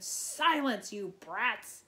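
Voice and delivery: high, far-off sneering command; commanding shout. A narrator's reading. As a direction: bark it to silence the brats. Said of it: "Tantalus shouted".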